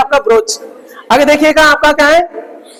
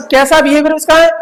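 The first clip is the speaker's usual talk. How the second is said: normal talking voice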